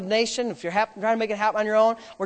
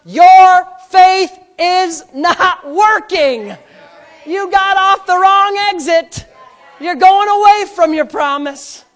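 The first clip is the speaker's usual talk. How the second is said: very slow, much louder shout; preaching shout; 7 semitones higher, 9 dB louder, slower than usual. Real, not acted. Pastor warns the congregation at full force, shouting. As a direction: hammer the warning home.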